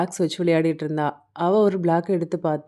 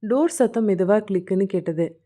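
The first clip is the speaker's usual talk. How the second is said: usual speaking voice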